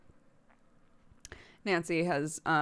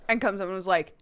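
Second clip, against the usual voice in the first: normal talking voice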